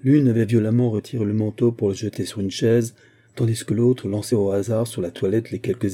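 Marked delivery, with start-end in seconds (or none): none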